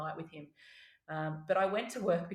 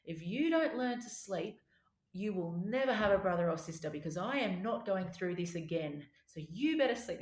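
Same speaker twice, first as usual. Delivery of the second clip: stern, slowed warning, pressing on key words; 2 semitones higher, 3 dB louder than usual. Retold or imitated. Mother warning her sleepless toddler over the cot, very stern. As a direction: lay down the law, firm and louder at points. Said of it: "had a very stern voice".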